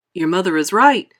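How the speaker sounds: loud, firm fatherly shout. A narrator's reading. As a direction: call it out loud. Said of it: "shouted Mister Beauregard".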